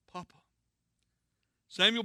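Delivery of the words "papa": faint, muffled call of Papa. Acted. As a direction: call weakly from under rubble. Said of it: "he heard a muffled voice".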